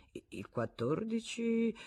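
slow, high, hesitant repeat; puzzled. Acted. Husband echoing the date, puzzled. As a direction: search your memory aloud. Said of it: "ripeté Arnoux guardando nel vuoto".

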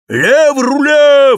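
high, slow, shouted command; shouted order. A narrator's reading. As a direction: bellow the order across the deck. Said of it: "вскричал Кэп".

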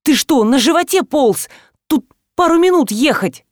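can't-hold-it-in reproach; exasperated outburst. A narrator's reading. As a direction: scold a friend for being late. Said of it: "не удержалась".

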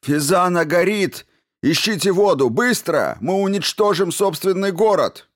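urgent high-pitched shout. A narrator's reading. Man warns fellow citizens of the burning city, shouting. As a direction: cry the alarm loudly, voice right up. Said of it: "это крикнул Хусари, подбегая к своим согражданам".

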